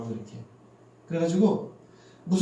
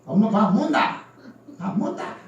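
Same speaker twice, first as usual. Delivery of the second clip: put-on playful Gyeongsang girl's casual ask, higher and slower; Gyeongsang dialect; 5 semitones higher, 4 dB louder, slower than usual. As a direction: mimic a Gyeongsang daughter asking her mom. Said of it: "반말을 해서 귀여워". (경상도 사투리로 귀엽게 반말하는 딸 흉내)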